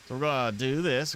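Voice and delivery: usual speaking voice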